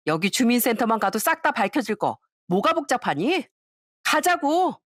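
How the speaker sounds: sneering classmate, taunting and pushy; a spiteful classmate exposing her lies; mocking classmate. (비꼬며 몰아붙이는 동창 목소리)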